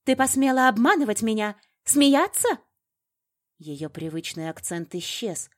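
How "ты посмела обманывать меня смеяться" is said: high, loud furious demand; shouted, incredulous fury; enraged accusation. A narrator's reading. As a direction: hurl the questions in outrage. Enraged woman accuses heroine at gunpoint.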